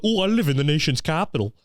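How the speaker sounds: high-pitched, preening mockery; mock self-important intellectual. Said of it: "think they're fucking smart and political".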